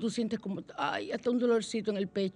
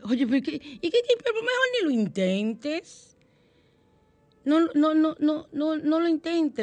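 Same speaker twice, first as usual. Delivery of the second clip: higher, louder mocking no no no; higher, hammed-up discouraging voice; mock dismissive; 7 semitones higher, 4 dB louder than usual. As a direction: brush it off in a cartoonish voice. Mocking voice answering.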